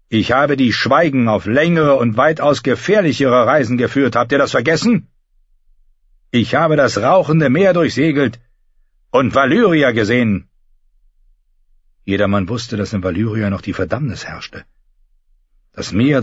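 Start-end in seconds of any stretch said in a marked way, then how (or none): 0.1-10.5 lightly voiced, proud and mocking; Euron. A narrator's reading. Euron boasting to the lords, lightly mocking. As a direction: boast calmly with a sneer.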